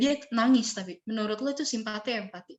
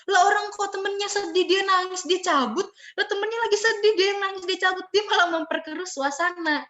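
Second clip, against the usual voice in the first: high-pitched, sing-along listing in disbelief; acted exasperated disbelief; incredulous complaint; 8 semitones higher, 3 dB louder than usual. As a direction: complain as if it makes no sense, high throughout. Acted disbelief at friend who leaves.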